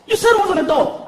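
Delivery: put-on high pushy kid. Son pestering dad, high kid voice. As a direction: demand it like a pestering boy.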